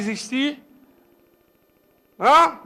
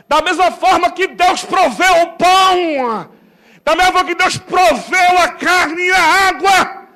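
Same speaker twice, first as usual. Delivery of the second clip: loud, high, slow preaching shout; rally the crowd, slowly and loud; fiery preaching; 6 semitones higher, 8 dB louder, slower than usual. Acted. Caleb rallying the people, shouted preaching.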